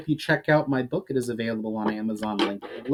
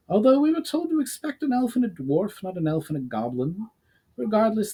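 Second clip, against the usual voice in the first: much higher put-on woman's voice; female commander; 9 semitones higher than usual. Commander woman answers her soldier.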